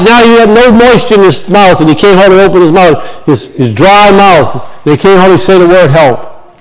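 ordinary delivery

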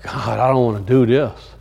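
low, soft tired grumble; quiet low reluctant moan; weary complaint. Retold or imitated. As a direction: mutter it sweating in a long line. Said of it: "He's like".

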